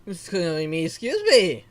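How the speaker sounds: hiccup jolting the words. Real, not acted. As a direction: caught mid-hiccup. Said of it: "Where did the hiccups come from?".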